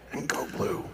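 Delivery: whispered go blue, embarrassed; hushed. Retold or imitated. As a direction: whisper it like a guilty secret. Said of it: "he lowers his voice".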